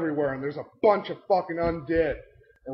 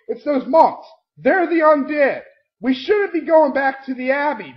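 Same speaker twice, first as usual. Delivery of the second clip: higher, louder, urgent warning; insistent warning; 8 semitones higher, 6 dB louder than usual. Retold or imitated. Warning the party about the monks, urgent and loud. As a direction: nag everyone that it's the monks. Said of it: "I'm constantly saying".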